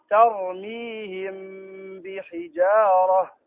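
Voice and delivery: very slow, drawn-out Quranic reading; tajweed recitation. Shown as a demonstration. Teacher showing how it is read, slow recitation. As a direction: stretch each syllable, humming through the hidden meem. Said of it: "فهنا تقرأ".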